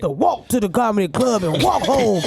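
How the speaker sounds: preachy veteran comic rant; old-school comedian voice. Retold or imitated. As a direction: lecture like a bitter old comic. Mocking old-school comedians' complaints.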